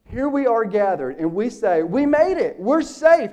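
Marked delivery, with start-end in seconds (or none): 1.8-3.3 high, loud cries of relief from the saved; joyful relief. Acted. Preacher voicing the redeemed crowd, joyfully loud and high. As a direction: shout it like you just made it home.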